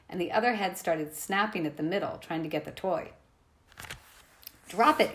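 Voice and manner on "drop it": high, louder command; firm order to a dog. Acted. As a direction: trainer ordering her dog. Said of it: "Annabeth ordered".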